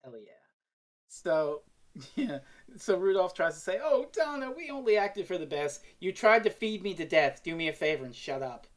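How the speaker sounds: ordinary delivery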